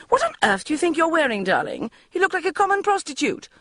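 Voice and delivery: high, fast, appalled mother voice; scandalised mother. A narrator's reading. Mother appalled by her daughter's outfit, high and fast. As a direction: rattle it off in shock at the outfit.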